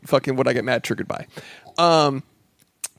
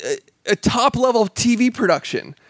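scolding a TV production, indignant and higher; incredulous rant; 7 semitones higher than usual. Retold or imitated. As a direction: drag out the last words in disbelief. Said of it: "And I was just like".

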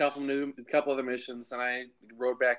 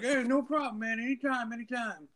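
high mock voice fading softer; friendly stranger; 10 semitones higher, 5 dB quieter than usual. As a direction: wave it off, anytime. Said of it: "And he's like".

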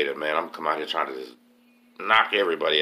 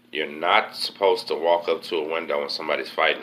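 ordinary talk

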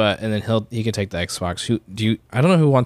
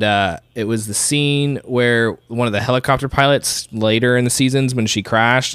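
ordinary delivery

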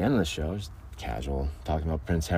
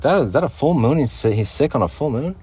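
higher, louder disbelieving question; surprised; 6 semitones higher, 5 dB louder than usual. Retold or imitated. The farmer asking about the full moon, surprised. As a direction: ask it in baffled surprise. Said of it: "He's like".

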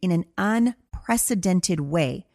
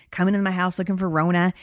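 normal talking voice

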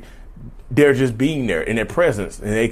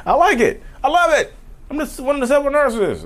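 mock bragging. Boasting about being a narcissist.

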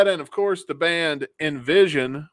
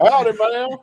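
higher, louder folksy howdy; 7 semitones higher, 7 dB louder than usual. Pretend. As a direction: greet like a Southern belle. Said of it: "[name] walks up".

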